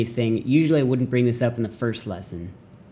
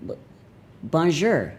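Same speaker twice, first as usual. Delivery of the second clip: badly pronounced French, pitched up then dropping; unsure, bad French accent; 5 semitones higher, slower than usual. Shown as a demonstration. Shy learner attempting French, in a bad French accent. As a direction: say bonjour in a clumsy English-speaker's accent. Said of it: "end up sounding way worse".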